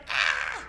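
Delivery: short loud low mock shriek; imitated scream. Therapist imitating her frightened client's scream, short and loud. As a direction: blurt a harsh low yell, imitating her. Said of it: "she started screaming and crying".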